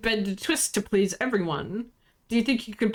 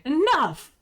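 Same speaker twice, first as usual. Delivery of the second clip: sharp command; 6 semitones higher than usual. Acted. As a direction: cut them off hard. Captain cuts off the bickering.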